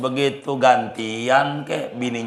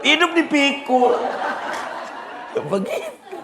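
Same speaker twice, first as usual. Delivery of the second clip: laughing disbelief. Retold or imitated. Villager laughs at the carried cow.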